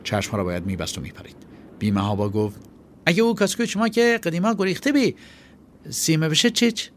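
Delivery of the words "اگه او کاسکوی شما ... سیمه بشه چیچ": put-on high, loud boy's voice, blurting it out; a kid jumping in with both feet; daring boy. Chaghak taking the risk.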